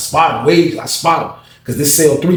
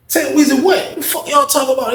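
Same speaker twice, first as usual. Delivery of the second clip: puzzled high question; 13 semitones higher than usual. Retold or imitated. Asking what's up.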